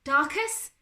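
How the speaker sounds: loud, high rising call; stunned, calling back in disbelief; stunned reply. A narrator's reading. Father answering his son's call, stunned. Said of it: "came a stunned reply".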